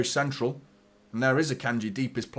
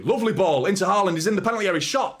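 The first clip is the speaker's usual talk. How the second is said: rising, loud, high call of the attack; high, loud live commentary burst; excited commentary; 7 semitones higher, 6 dB louder, faster than usual. Real, not acted. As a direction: lift the voice as Haaland breaks through. Chance for Haaland, live commentary.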